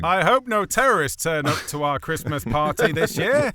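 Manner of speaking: loud, slow, heavy-handed line reading; hammed-up bad dialogue. Parodying unsubtle theme dialogue in Die Hard, hammed-up delivery. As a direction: deliver it loudly, far too obviously.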